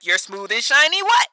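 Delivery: incredulous rise on the last word; mock shock. Listener reacting to a racy lyric, mock shock. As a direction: echo it back in scandalized disbelief.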